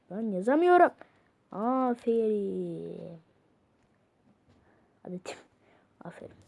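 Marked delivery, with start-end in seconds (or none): 1.5-3.2 drawn-out low patronising aferin; slow mock praise. Patronising the chatbot, slow and low. 5.0-6.4 whispered aferin; whispered praise. Pretend. Whispering praise to the chatbot.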